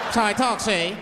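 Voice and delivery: put-on gangster patter; talking crazy. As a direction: insist like an old-time movie bad guy. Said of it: "I'll talk crazy".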